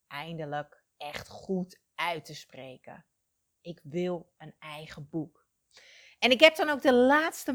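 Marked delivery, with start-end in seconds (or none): 0.1-5.5 whispering. Real, not acted. A confession of her dream.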